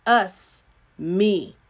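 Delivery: usual speaking voice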